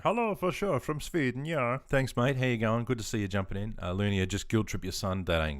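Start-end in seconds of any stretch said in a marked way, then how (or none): 0.0-1.8 high, playful mock Swedish accent. Streamer greeting a viewer from Sweden, mock Swedish accent. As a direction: put on a sing-song Swedish accent. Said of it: "and hi from Sweden".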